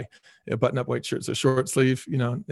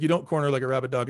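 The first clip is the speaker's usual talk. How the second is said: usual speaking voice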